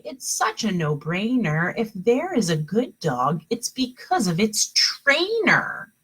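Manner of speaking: plain reading voice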